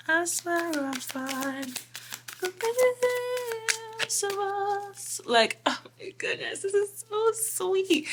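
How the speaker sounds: high, slow mock gushing voice; gushing partner. Mock gushing to their partner, high and slow. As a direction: coo it out like a smitten partner.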